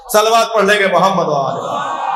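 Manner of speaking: rally the congregation to send blessings; call for salawat. Real, not acted. Preacher calls for salawat.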